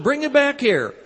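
high, loud sudden command; retold command to the ushers; commanding call. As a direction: call it out with unexpected authority.

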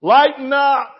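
loud jolly command; lighten up. Retold or imitated. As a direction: burst out with it. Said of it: "his way of saying".

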